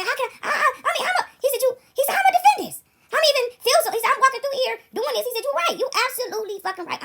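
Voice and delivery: high, strained imitation of an overwhelmed young man; distraught son. Son pouring out his despair in the cafeteria, voice high and strained. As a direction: pitch up as if he is breaking.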